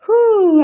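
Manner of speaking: high, loud exhaled whew; relieved whew. Real, not acted. Closing her live stream, big loud relieved whew. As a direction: blow out a big relieved breath.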